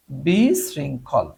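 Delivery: বিশৃঙ্খল drawn out slowly, syllable by syllable; slow dictation. Shown as a demonstration. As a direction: spell out বিশৃঙ্খল slowly for the class. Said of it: "chaotic মানে হচ্ছে বিশৃঙ্খল". (ধীরে ধীরে টেনে বলা)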